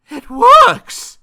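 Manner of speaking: high, amazed cry; loud wonderment. A narrator's reading. Mister Jelly finding counting works, crying out in wonderment. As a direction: exclaim it loudly, astonished. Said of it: "said out loud in wonderment".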